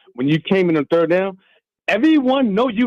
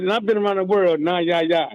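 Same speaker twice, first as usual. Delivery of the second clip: usual speaking voice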